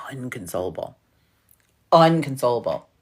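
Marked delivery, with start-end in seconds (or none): 0.1-1.0 low, whispery hush; hushed for emphasis. Real, not acted. Teller stressing how hard they laughed, hushed. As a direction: drop to a near whisper on the word.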